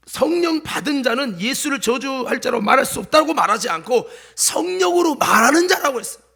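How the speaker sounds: usual speaking voice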